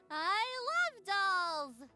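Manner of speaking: loud, high, drawn-out fake delight; forced enthusiasm. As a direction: oversell the love, stretching each word. Overselling a lie about loving dolls.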